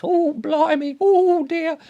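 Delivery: very high, trembling mock panic; mock quivering fright. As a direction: squeak it out like a terrified townsman. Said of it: "everyone's all quivering like this".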